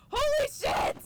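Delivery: high, loud burst of disbelief; elated cry. Real, not acted. Still reeling that they have the case file, elated shout. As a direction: blurt it out in joyful shock.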